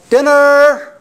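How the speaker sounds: high, loud call for dinner across the apartment; yelled call. Retold or imitated. Single mom calling her kids to dinner, high loud yell. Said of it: "she yelled".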